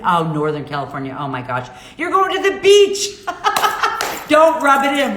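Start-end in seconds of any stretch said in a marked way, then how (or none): 2.0-4.3 high laughing tease. Real, not acted. Laughs at viewer's beach trip. 4.3-5.2 playful protest. Real, not acted. Playful complaint to viewer.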